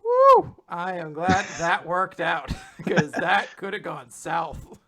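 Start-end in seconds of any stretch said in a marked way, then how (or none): 0.0-2.5 mock relief after a close call; relieved whew. Hiyori relieved her plan worked, loud mock relief. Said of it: "sitting there like".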